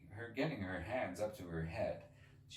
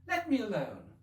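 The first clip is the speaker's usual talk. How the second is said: put-on louder, higher child's protest; indignant; 12 semitones higher, 7 dB louder than usual. Alice pushing the pigeon away, indignant. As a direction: snap back, offended. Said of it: "said Alice indignantly".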